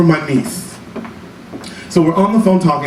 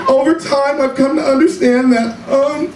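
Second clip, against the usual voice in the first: lofty uncle; 8 semitones higher than usual. Retold or imitated. Uncle answering niece, lofty tone.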